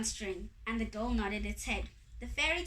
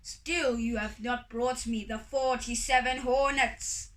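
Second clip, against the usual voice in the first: loud, forceful demand; big imperious voice; roaring sky god; 5 dB louder than usual. A narrator's reading. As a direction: declare it loudly as a demanding god.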